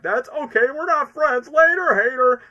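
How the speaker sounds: high, loud brush-off, building; hammed-up high dismissal; movie character impression. Imitates a movie line.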